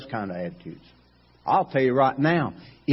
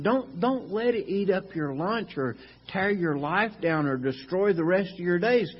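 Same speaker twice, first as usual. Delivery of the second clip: ordinary talk, nothing marked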